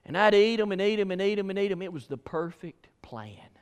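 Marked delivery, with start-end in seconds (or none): 1.8-3.5 secret whisper, barely voiced. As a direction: let it out like a conspiratorial secret. Sneaky kid's secret plan.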